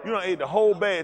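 loud, high exclamation; exclaiming. Real, not acted. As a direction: call them out, loud and high. Exclaiming at the viewer.

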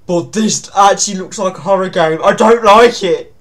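loud, high, rising alarm; spooked outburst building to a shout; alarmed protest. Real, not acted. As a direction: protest loudly in fright. Alarmed by how real it looks.

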